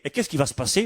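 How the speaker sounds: usual speaking voice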